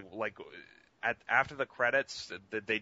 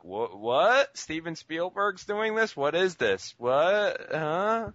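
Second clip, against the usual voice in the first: mock confusion; 6 semitones higher, 4 dB louder, slower than usual. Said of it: "everyone was just, like, kinda like".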